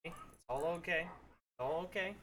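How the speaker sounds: calm the dogs gently; soothing. Real, not acted.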